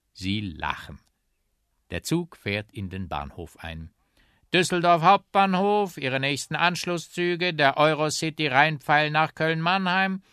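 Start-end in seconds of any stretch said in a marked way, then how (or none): 4.5-10.2 high, loud station announcement; station announcer. Acted. Train pulls into Düsseldorf main station, loud announcer voice. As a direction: call it out over the platform.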